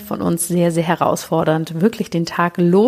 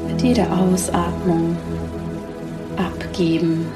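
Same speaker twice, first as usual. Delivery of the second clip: sleep meditation. Acted. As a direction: guide them gently into sleep.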